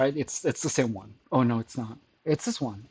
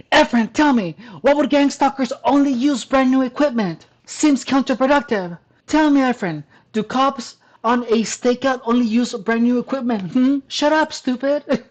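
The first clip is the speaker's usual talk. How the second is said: mocking taunt; 6 semitones higher than usual. Mocking the commenter's taunting reply.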